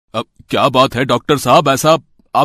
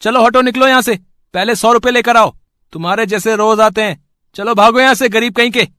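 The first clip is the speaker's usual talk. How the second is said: rude shopkeeper; 10 semitones higher than usual. Acted. Shopkeeper shooing Vipin away. (ग़रीब ग्राहक को भगाता लालची दुकानदार, ऊँची तेज़ आवाज़ में)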